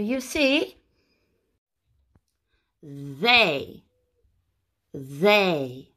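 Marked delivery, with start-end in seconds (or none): 2.8-5.9 THEY with a long, voiced th, falling; modelled pronunciation. Shown as a demonstration. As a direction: draw out THEY low and slow for learners. Teacher models a new word.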